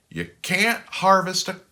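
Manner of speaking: high, loud, slow mother voice; a busy farm mom setting him straight; high scolding farm mother, slow; practical mother. Acted. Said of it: "his mother would say".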